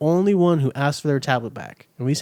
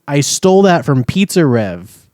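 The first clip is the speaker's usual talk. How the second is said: loud confession; 9 dB louder than usual. Real, not acted. Boasting to co-host about stolen tablet, loud and proud. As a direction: announce the theft, loud and deliberate.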